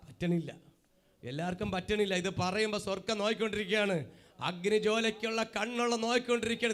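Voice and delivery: ordinary delivery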